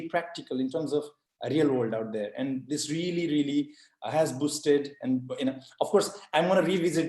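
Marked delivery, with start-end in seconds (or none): none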